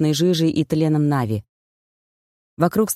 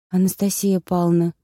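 plain reading voice

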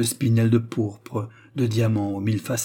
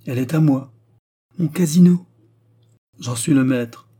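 louder, higher gloating; triumphant claim; 4 semitones higher, 6 dB louder than usual. Acted. The gentleman claiming Pulcinella's casino, triumphant. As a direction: crow over the winnings.

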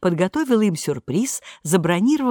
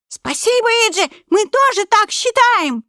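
squeaky cartoon voice; 5 dB louder than usual. Mickey answers after his dance.

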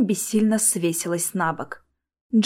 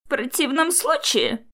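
higher-pitched hoarse threat; хриплая угроза; 6 semitones higher than usual. A narrator's reading. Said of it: "хрипло объявил он". (хриплая угроза повышенным тоном)

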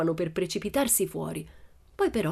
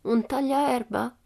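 put-on slow, high teasing girl's question; 8 semitones higher, slower than usual. A narrator's reading. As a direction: tease the burglars deadpan. Pippi's voice continues. (domanda lenta e acuta da bambina)

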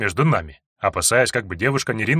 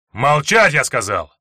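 властный крик; 3 semitones higher, 5 dB louder, slower than usual. Acted. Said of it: "И ему пришлось крикнуть".

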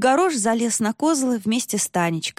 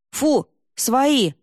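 higher-pitched warning call; окрик; 6 semitones higher, 4 dB louder than usual. A narrator's reading. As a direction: call off the dog with a moderate shout. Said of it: "крикнул Сергей". (негромкий окрик)

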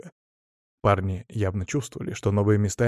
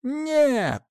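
very high, drawn shout of refusal; children's chorus. A narrator's reading. Children answer the teacher all together, high cry. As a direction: voice a whole class refusing, way up high. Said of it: "затем хором выдали".

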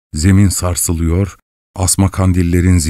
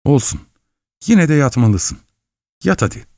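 a hesitant woman's voice, pitched higher; hesitant, changed voice; 7 semitones higher than usual. A narrator's reading. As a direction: coax the boy with a wavering, higher voice. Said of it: "yine değişen, mütereddit bir sesle".

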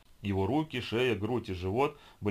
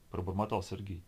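ordinary delivery

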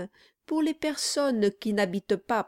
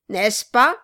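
taunting shout; 9 dB louder than usual. Acted. Quilp repeats it as he hits the figurehead, loud. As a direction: strike again with the question.

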